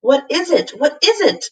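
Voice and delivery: gasped in panic. A narrator's reading. Ben under the tree, panicked gasp. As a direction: gasp it out in fear. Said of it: "gasped Ben".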